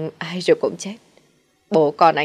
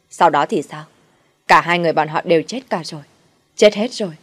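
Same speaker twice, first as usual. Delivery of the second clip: agitated shouting; 5 dB louder than usual. Acted. Son shouting at his mother about dead brothers. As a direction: lose control, shouting the deaths. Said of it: "Đức Bình lại kích động la hét".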